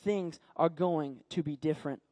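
low, soft, earnest inner monologue; Samuel's quiet resolve. Acted. Boy resolves in his heart, quietly earnest. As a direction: speak inwardly with growing conviction, hushed.

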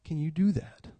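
a touch of quiet disgust, imitating the visitor; almost disgusted. Pastor retells the visitor's reaction, mildly disgusted. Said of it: "he was almost disgusted".

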